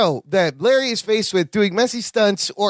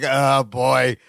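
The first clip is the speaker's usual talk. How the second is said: low-pitched, mildly frustrated sigh of words; Bink; 8 semitones lower than usual. Retold or imitated. Bink watching Balky take over his job, mild frustration. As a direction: imitate a host losing patience. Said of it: "gets increasingly more frustrated".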